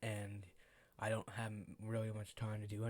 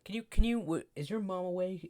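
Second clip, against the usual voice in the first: principal asking; 7 semitones higher, 9 dB louder than usual. Retold or imitated. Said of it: "She's like".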